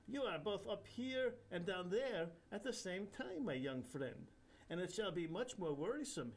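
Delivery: a playful Indian holy man teasing; Indian accent. Acted. Indian guru chiding a floating man, Indian accent. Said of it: "in a highly nasal Indian accent".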